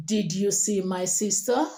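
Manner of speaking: level start, sharp lift on "sister"; rising intonation. Shown as a demonstration. Teacher models asking a question, voice going up on "sister". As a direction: let the question climb at "sister". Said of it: "So it's going up".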